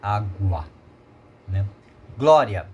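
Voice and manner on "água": "Água" sounded out low as á-guA; syllable breakdown. Shown as a demonstration. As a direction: separate the syllables of "Água", letting the final A ring over the weak U. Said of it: "vogal A".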